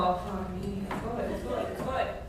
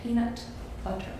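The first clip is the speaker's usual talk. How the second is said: American accent; 7 dB quieter than usual. Shown as a demonstration. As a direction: try "Peanut butter" the American way. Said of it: "I use the word peanut butter".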